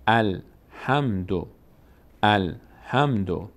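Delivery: الحمدو read very slowly, sounds joined; slow blended reading. Shown as a demonstration. Teacher slowly models الحمدو for learners. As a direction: stretch الحمدو out for the class. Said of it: "حرف ما قبل رو میچسبونیم به اینها".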